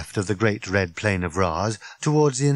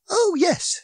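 put-on high-pitched cheery little old lady; wizened lady's chirp, pitched high; piping cheerily. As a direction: pipe it up cheerfully. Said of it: "she piped cheerily".

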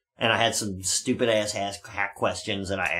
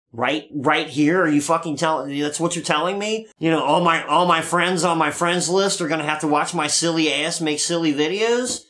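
excited; 7 semitones higher, 5 dB louder than usual. Retold or imitated. Retelling his Facebook Live thrill.

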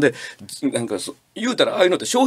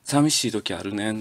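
soft autumn confession; 6 semitones lower, 5 dB quieter than usual. Shown as a demonstration. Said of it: "感じで".

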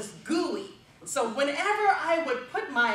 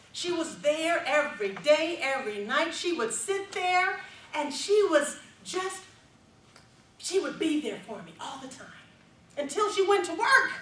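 ordinary delivery

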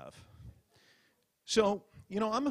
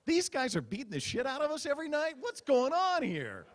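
mock disbelief; 12 semitones higher than usual. Stand-up mocking rival newscast.

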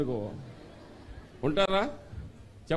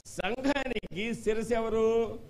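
slow question; 4 semitones higher, much slower than usual. Shown as a demonstration. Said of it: "జాగ్రత్తగా వినండి".

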